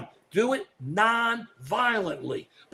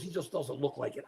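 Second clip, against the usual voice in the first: usual speaking voice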